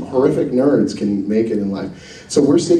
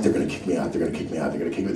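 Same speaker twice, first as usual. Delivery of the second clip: low, soft mutter on repeat; acted panic under the breath; anxious muttering; 7 semitones lower, 9 dB quieter, much faster than usual. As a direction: mutter it to yourself like a nervous mantra. Retold anxious thoughts while sitting down.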